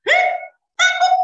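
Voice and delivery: put-on high, loud startled child's yelp; a boy shocked his father is afraid; child surprise. Young Ismail gasping in surprise, high and loud.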